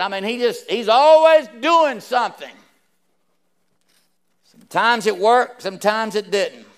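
rising pulpit fervour; preaching at full force. Acted. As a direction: raise the voice and preach it. Preaching builds up.

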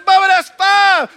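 high, loud amazed cry; excited Jethro. Acted. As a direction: shout it out, stunned with joy. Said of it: "they were all excited about their healing".

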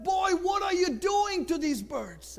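high-pitched, indignant acted demand; alarmed confrontation. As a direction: confront the boy in dismay. Pastor confronts boy harming his birds.

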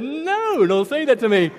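high-pitched comic protest; mock dismay. Nervous player to his coach before the game, mock dismay. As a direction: blurt it out in mock dread. Acted panicked reply to coach's demand.

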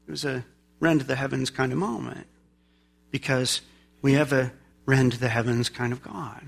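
low, soft preaching refrain; hushed reverence. Real, not acted. Preacher reflecting on God coming down, hushed. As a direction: drop low and soft, letting it settle.

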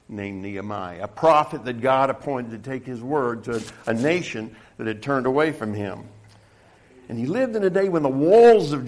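emphatic pulpit storytelling, swelling on key words; preaching. Real, not acted. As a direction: preach it to the room with rising force.

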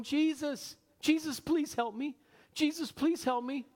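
high-pitched, restrained pleading; mock mild plea. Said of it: "she wasn't just going".

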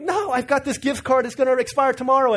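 eager mom voice. Retold or imitated. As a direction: play the overeager mom jumping at the chance.

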